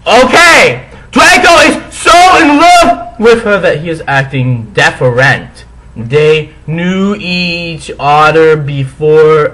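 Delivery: overacted indignant author voice; hammy stage actor. Hammy author's note rant.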